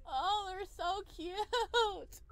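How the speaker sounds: usual speaking voice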